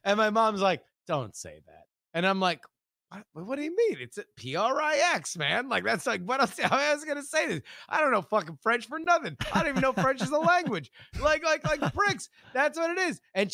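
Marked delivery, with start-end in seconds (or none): 1.1-1.8 low, hushed motherly warning; mock stern mom, barely raised voice; quiet disapproval. Mom's stern reply retold. 3.3-13.2 fast, high-pitched protest; hammed-up childish indignation; indignant child.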